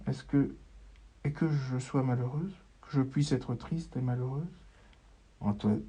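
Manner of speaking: usual speaking voice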